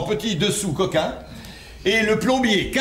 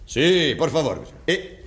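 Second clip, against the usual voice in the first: put-on Spanish accent. Husband fooling the jealous caller in a put-on Spanish accent. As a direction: play the Spanish plumber on the phone with a Spanish accent. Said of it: "Pourquoi tu as pris cet accent stupide".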